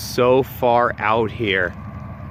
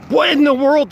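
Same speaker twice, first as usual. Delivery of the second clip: mock surprise; 13 semitones higher, 5 dB louder than usual. Staged reaction, then praising the take.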